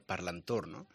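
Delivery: ordinary delivery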